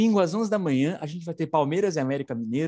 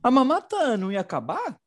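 feigned puzzlement, pitched well up; mock surprise; 5 semitones higher than usual. Host needles the government over paying a friendly presenter, mock surprise. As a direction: play baffled, voice jumping high.

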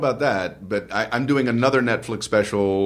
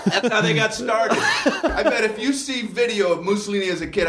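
laughing; 11 semitones higher than usual. Real, not acted. Joking about Mussolini.

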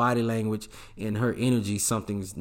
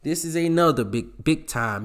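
normal talking voice

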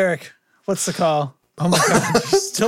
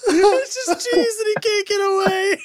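laughing; 8 semitones higher than usual. Real, not acted. Helpless laughter at cat gif.

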